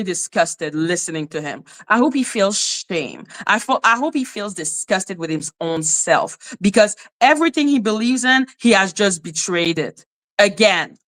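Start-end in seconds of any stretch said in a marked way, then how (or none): none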